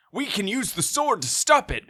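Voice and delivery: high, loud hammed-up heroic summons; mock heroic call. Host voicing Damon summoning the dragons, hammed-up heroic. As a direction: call on the legends like an anime hero. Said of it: "And he's like".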